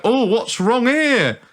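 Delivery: slow, drawn-out Yorkshire grumble; Yorkshire accent. Acted. Stopped passenger to airport security, in a Yorkshire accent. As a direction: put on an indignant Yorkshire bloke. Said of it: "I'm giving him a Yorkshire accent".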